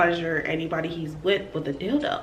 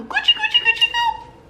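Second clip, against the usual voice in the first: very high, cutesy squeak; mock baby-talk coo; tickling singsong; 3 dB louder than usual.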